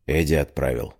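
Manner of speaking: brief, low question; curt question. A narrator's reading. Amir questions the girl he caught, curt and low. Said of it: "кратко спросил Амир".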